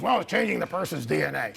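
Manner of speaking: lightly voiced gruff surgeon; a hint of gruffness in the mimicry; gruff imitation. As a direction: imitate a gruff doctor giving his verdict. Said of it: "in sort of a gruff, and I'll do my best imitation".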